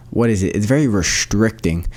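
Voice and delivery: ordinary delivery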